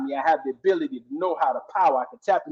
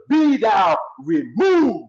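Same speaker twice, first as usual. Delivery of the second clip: commanding shout; 4 semitones higher, 5 dB louder, much slower than usual. Acted. Commanding the mountain in preaching.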